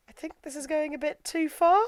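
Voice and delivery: high, rising, hesitant voice; tentative objection. Retold or imitated. Roberto objecting to frog hunt, mock tentative protest. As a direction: object timidly, pitch creeping up.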